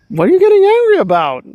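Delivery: high, loud incredulous question; mock bewilderment. Puzzled bystander answering Nora's tirade, high mock bewilderment. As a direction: ask it high-pitched, in mock disbelief.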